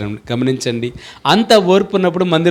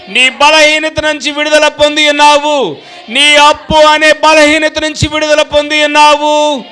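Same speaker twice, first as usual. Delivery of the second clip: higher, louder fervent declaration, slowing on the key lines; preaching at full force; 11 semitones higher, 5 dB louder than usual. Acted. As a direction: proclaim deliverance over the crowd with all your breath. (పూర్తి శక్తితో ఉత్సాహంగా ప్రసంగం)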